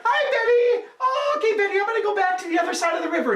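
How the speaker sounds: squeaky high-pitched monkey chatter, fast and giggly. A narrator's reading. Monkey saying goodbye to his girlfriend Betty, high and quick. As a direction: play the cheeky monkey, way up high.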